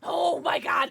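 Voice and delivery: sudden loud Oh my God; real exasperated exclamation; startled outcry. Real, not acted. Cat chaos on stream.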